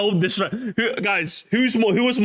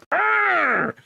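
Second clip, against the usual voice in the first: mock angry growl; 8 semitones higher than usual. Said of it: "have you go".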